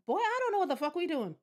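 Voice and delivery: exasperated. Real, not acted. Baffled by the game.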